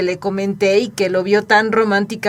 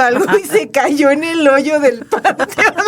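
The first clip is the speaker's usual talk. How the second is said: laughing; 2 semitones higher than usual. Real, not acted. Laughing while retelling cousin's fall.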